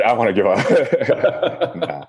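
joking despair through a laugh. Real, not acted. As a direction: say it laughing, mock giving up. Joking about homeowning.

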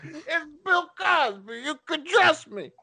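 Bill Cosby impression. Introducing himself to the listener, high and slow with oily charm. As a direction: lure them in with fake trust. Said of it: "that's my Bill Cosby impression".

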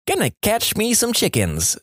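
put-on high-pitched child; kid's voice. Kid answers old man about chicken wire, high child voice. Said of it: "says the kid".

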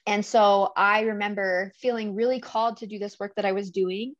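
usual speaking voice

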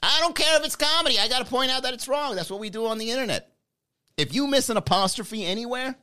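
high, nasal-free snooty pedant voice; nitpicking commenter. Retold or imitated. Commenter correcting Manny, high snooty voice. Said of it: "Sometimes role-playing gets me upset".

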